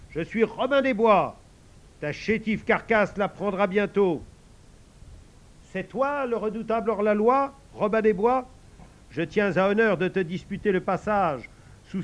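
high loud grand declaiming; heroic outlaw boast. Acted. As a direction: swagger like a legend. Heroic role dialogue.

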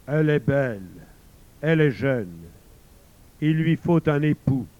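loud, booming divine voice; voice of God. Acted. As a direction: boom down from the heavens. God answers.

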